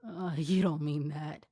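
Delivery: slow, hesitant mock nerves; said nervously. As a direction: back off nervously, unsure. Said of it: "Kiba said nervously".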